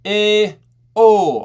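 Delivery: "eh, oh" called out high and loud; open-belt vowels. Shown as a demonstration. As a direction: sound out "eh, oh" as separate open vowels. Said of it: "For open belt".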